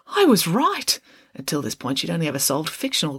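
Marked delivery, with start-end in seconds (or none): 0.1-1.0 much higher, delighted; thrilled. A narrator's reading. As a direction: announce it with glee. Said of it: "exclaimed Friday".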